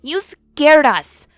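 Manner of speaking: slow, high, very loud delighted yell; elated yell. Acted. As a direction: shout it with thrilled joy. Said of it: "one of the kids yelled".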